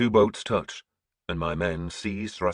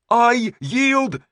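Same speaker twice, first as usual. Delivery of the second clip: loud, much higher cry of surrender; 9 dB louder than usual. A narrator's reading. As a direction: shout the surrender desperately. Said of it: "he cried again".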